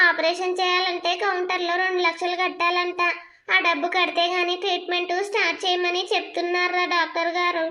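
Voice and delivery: usual speaking voice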